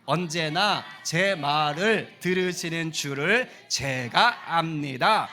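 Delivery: very slow, loud scripture reading; loud group reading. Shown as a demonstration. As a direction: read the verse out slowly together. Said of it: "큰 소리로".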